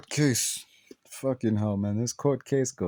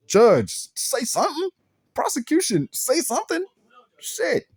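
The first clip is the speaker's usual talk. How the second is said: high-pitched mock pleading with the court; exasperated appeal; 10 semitones higher than usual. Viewer calling out to a silent courtroom, exasperated. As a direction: demand a reaction, voice pitched way up.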